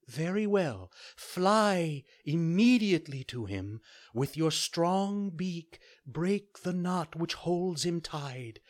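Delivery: high, gentle fairy voice; a kind fairy giving a calm order. Acted.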